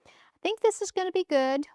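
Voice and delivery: normal talking voice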